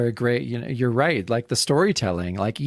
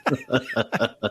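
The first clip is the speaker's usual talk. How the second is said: laughing through the words, then a high squeal of laughter; real amused laughter. Real, not acted. Co-host cracking up at the cape joke, laughing. Reacting to co-host's whisper joke.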